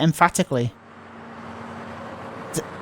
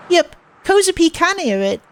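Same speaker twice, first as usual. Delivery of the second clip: put-on cutesy girl voice; 13 semitones higher than usual. Transfer student soothing Nishizuo-kun, high cutesy baby talk.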